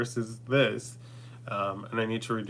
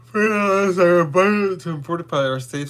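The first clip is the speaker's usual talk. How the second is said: loud proclamation; 7 semitones higher, 9 dB louder than usual. Acted. Spiritual blessing proclamation.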